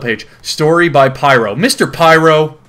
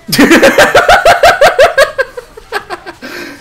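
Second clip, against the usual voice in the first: high laugh; 6 dB louder than usual. Real, not acted. Laughing hard at story.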